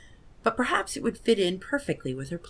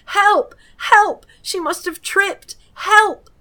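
loud, high, panicked cries for help; screaming for help; 11 dB louder, much slower than usual. Acted. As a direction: shriek it out, high and desperate. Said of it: "begin to scream".

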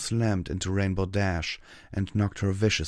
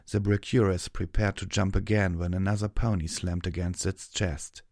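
ordinary delivery, nothing marked

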